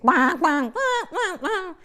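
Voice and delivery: high sobbing wah-wah of sad strings; mock dramatic music. Imitating the telenovela's tragic soundtrack, high and wailing. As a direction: sing the soap's sad swelling music. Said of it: "And then you hear the music".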